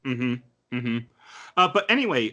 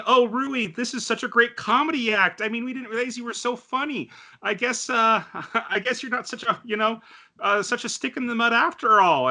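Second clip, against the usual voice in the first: higher, lightly voiced amused classmates; teasing classmates; 7 semitones higher than usual. Classmates teasing Rui about her comedy act, lightly voiced and higher. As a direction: chirp it like a class enjoying the joke. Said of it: "the class starts laughing and starts saying".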